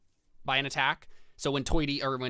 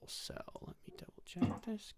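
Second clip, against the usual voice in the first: whisper; 8 semitones higher, 11 dB quieter than usual. Real, not acted. GM checking notes.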